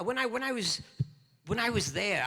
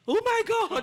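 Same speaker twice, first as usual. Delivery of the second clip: high, loud startled cry; shrieked oh my God, much higher and louder; real surprise; 9 dB louder than usual. Real, not acted. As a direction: burst out in sudden shock. Sudden excited exclamation.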